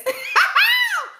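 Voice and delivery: real shrieking laugh at a comment. Real, not acted. Reacting to June's comment.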